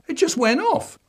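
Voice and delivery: put-on high whiny kid voice; boy pleading innocence to his dad; child voice. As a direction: squeak it out like a guilty little boy. Dad repeating the boy's line.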